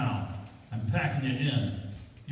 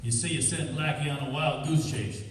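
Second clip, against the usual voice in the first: usual speaking voice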